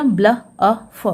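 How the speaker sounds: "ब्ल, अ, फ" as separate phonics sounds; sounded out. Shown as a demonstration. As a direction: say "ब्ल", "अ", "फ" one by one, teacher-style. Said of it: "तो क्या हो गया". ("ब्ल, अ, फ" को अलग-अलग ध्वनियों में बोलें)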